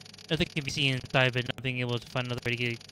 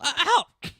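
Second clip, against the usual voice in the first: sharp cry of pain; 9 dB louder than usual. Real, not acted.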